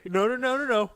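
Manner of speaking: mock Hogan despair. Hogan's face read aloud.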